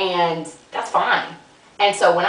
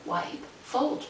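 whispered reminder; 3 semitones higher, 9 dB quieter than usual. Shown as a demonstration. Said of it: "So remember".

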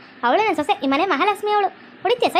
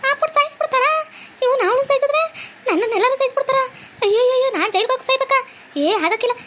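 put-on panicked old mother-in-law; 8 semitones higher than usual. Mother-in-law panicking over her son, high frantic wail. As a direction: shriek like a mother terrified her son will land in jail.

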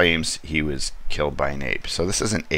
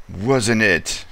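"Wasn't it?" repeated higher and louder; cutely dramatic; 6 semitones higher, 4 dB louder than usual. Retold or imitated. The detective insists it was the ape, hammed-up drama. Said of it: "really cutely dramatic".

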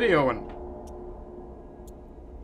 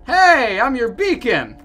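high, loud hail, then slower; calling out; 8 semitones higher, 8 dB louder, slower than usual. Pretend. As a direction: shout across the dark city. Calling out to survivors.

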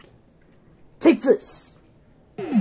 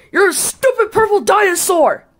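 higher shouted insult; shouting; 9 semitones higher, 5 dB louder than usual. Real, not acted. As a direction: yell it out, higher and louder.

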